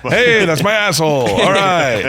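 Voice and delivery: high, loud hammed-up porn voice; mock porn actor. Host riffing on the comedian as a porn star, high hammy voice. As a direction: ham it up like a cheesy porn actor. Joking impression of porn lines.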